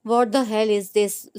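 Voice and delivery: mildly acted exasperation; a touch of irritated disbelief; exasperated. A narrator's reading. Sufia annoyed at a crowd blocking the road, mildly exasperated. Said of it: "सूफिया ने कोफ्त से".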